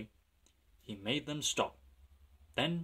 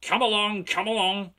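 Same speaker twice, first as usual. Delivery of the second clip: loud, higher-pitched urging; puffing urging; 9 semitones higher, 7 dB louder than usual. A narrator's reading. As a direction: chug it out, urging them on. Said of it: "he puffed".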